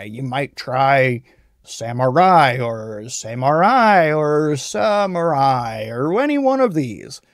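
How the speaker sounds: samurai stretched out slowly, each syllable spelled out by ear; slow, deliberate phonetic play on samurai; sounded-out samurai variants. Shown as a demonstration. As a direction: say samurai several ways, slowly. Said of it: "telling a friend how to pronounce a word".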